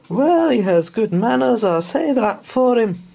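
high, loud, grudgingly approving; a stern old housekeeper softening. A narrator's reading. Mrs Bird noticing the bear's manners, grudging.

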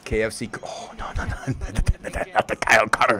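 normal talking voice

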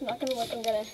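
normal talking voice

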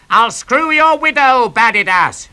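high, loud jeering yell; taunting shout. A narrator's reading. Cretan prince taunting a Trojan, high jeering shout. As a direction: yell the insult at the enemy.

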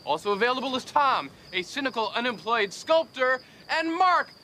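high, loud hawker's call; mock announcement. Joker advertises his friends to rich ladies, loud mock announcement. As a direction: shout it out to the whole neighborhood.

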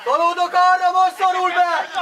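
shouted call. Real, not acted. Player telling teammates he is shifting over, shouted call. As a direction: call it long across the field.

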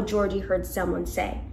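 ordinary delivery

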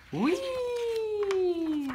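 a long sustained high squeal; long high whee. A narrator's reading. A frog child flying in a dream, long high whee. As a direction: sing out a drawn-out whee.

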